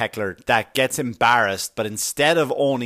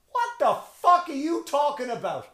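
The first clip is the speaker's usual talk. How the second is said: mock shocked squeal; 14 semitones higher than usual. Daughter's imagined reaction.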